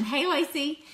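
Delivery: ordinary talk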